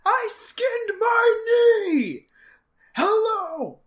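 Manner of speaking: put-on high, loud whiny kid wail; crying kid voice. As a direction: wail like a hurt little boy. Voicing Opie crying out.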